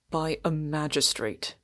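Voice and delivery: low-keyed, terse correction; curt. A narrator's reading. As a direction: answer curtly, dropping the volume. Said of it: "said the other curtly".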